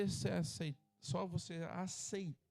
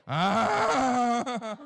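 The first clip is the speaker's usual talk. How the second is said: loud braying mock laugh, higher; imitated laugh; 6 semitones higher, 12 dB louder than usual. Pastor imitating his wife's laugh for the church, loud braying mock laugh. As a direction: bray it like a car stalling. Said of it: "Ela fica rindo".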